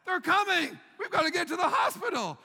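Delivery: high, loud frantic yell to his wife; husband yelling the twins are coming, loud and high; panicked shout. Retold or imitated.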